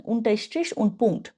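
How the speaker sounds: very slow, careful German for learners; slow German demo. Shown as a demonstration. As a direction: lay out each word slowly for the class. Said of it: "তাহলে ডয়েসটা বলেন". (ধীরে ধীরে German উচ্চারণ)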